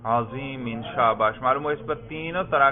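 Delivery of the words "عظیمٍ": عظیماً said with final -in, "azimin"; genitive ending pronounced. Shown as a demonstration. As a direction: end عظیماً on a clear -in. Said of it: "جر پہ کیجئے".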